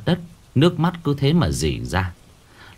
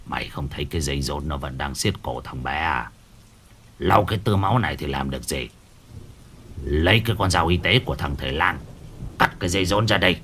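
put-on low, quieter, scolding old man voice; gruff old shaman; 6 semitones lower, 4 dB quieter than usual. Old shaman scolding the flustered midwife, low and harsh. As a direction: growl it down low like a stern old village shaman.

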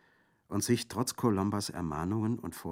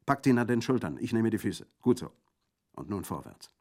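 loud, higher quick commands; barked orders; 4 semitones higher, 7 dB louder than usual. Acted. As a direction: give the orders over the noise. Bandit giving orders.

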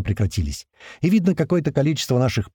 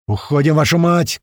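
loud, higher order; urgent shout; 5 semitones higher, 5 dB louder than usual. A narrator's reading. Commander pulling the squad out, loud urgent shout. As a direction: order everyone out at once. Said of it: "кричит Туман".

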